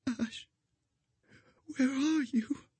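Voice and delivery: high, soft fading dying voice; bleeding out. A narrator's reading. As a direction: reach out weakly while dying. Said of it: "dying woman reaches out".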